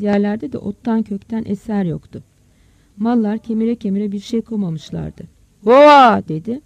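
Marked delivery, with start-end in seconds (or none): 5.6-6.2 very loud, higher cattle call; herding call. Acted. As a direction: holler at the herd. Farmer calls to the cattle. (hayvanlara seslenir gibi haykırarak)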